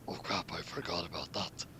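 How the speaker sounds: hushed guilty aside; whisper. Acted. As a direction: whisper it sheepishly with a chuckle. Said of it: "Saber said that felt bad".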